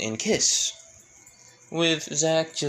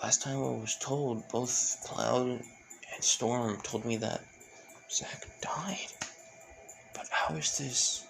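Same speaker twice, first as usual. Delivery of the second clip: soft, breathy thinking aloud to herself; hushed, puzzled inner voice; inner thoughts; 9 dB quieter, slower than usual. A narrator's reading. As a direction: whisper it like a private thought. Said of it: "that's her thinking".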